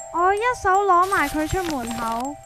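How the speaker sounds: usual speaking voice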